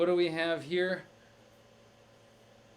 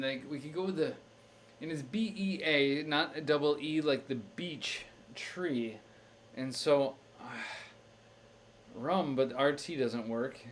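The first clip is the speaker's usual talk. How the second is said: ordinary talk, nothing marked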